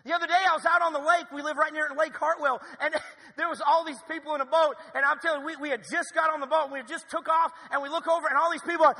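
high, loud sermon story; loud preaching storytelling. Acted. Pastor telling the lake story, loud and high. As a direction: tell it big to the whole room.

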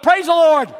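high, loud exclamation; loud celebratory shout; jubilant relief. Retold or imitated. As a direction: burst out in mock-relieved praise. Relieved thought after hanging up.

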